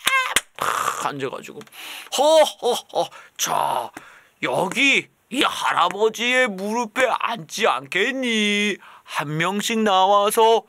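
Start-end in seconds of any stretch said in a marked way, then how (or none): 2.2-10.6 slow, loud jolly Santa invitation; hammed-up kindergarten Santa. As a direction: coax the kids onto his lap. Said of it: "이러면".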